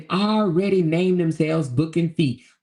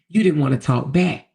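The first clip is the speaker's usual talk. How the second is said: normal talking voice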